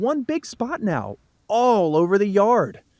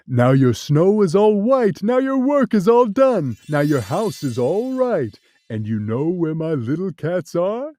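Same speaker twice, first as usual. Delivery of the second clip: louder, lightly voiced cat; the cat gloating; 2 semitones lower, 5 dB louder than usual. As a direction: boast to the kids. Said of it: "laughed the cat".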